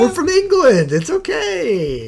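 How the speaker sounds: high, drawn-out goofy voice; squeaky clueless tourist; mock tourist voice.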